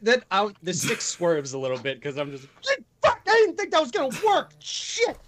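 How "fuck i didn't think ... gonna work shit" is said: high, fast startled yell; surprised outburst. Acted. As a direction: blurt it out in shock. Player acts character's surprised outburst.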